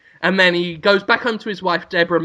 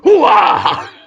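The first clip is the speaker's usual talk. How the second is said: Al Pacino; 2 semitones higher than usual. Retold or imitated. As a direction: play it like Pacino reassuring the couple. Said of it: "Al Pacino's like".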